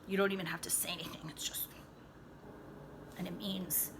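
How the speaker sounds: fast hushed whisper; quick conspiratorial whisper. Real, not acted. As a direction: rush it as a whispered aside. Aside about mom look.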